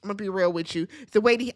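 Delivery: normal talking voice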